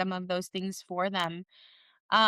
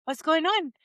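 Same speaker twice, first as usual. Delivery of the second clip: mock surprised mom; 8 semitones higher than usual. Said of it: "I was like".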